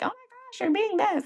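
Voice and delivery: high mock-exasperated spouse; mock exasperation. Spouse mock-complaining to spouse in a high voice. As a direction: squeak it out as a jokey spouse complaint. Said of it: "you're like".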